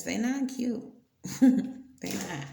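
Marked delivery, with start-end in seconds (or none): 1.2-1.8 burst of laughter. Real, not acted. Laughing at her own remark.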